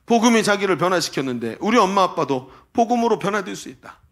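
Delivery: ordinary delivery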